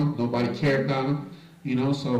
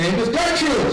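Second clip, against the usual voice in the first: high call across the lot; 12 semitones higher, 5 dB louder than usual. Retold or imitated. Manager calling out to a resident riding by, loud and high. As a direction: hail her as she rides past.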